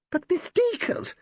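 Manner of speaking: very high slow whine; whiny starlet. Acted. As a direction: drag it out in a whine. Valerie whining.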